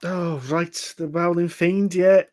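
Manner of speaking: lightly put-on Scouse; Lister's Scouse voice. Stranger recognises the boat described, Scouse. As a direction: play a Scouse local. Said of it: "gonna go for a Lister voice".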